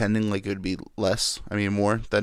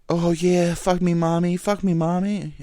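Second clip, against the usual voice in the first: mock porn line; 8 semitones higher, 5 dB louder than usual. Mocking stepmom porn lines.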